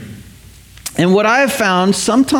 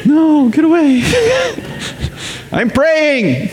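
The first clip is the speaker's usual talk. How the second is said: higher, laughing mock shoo; 8 semitones higher, much slower than usual. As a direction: shoo the kids away through laughter. Adult hiding in bathroom.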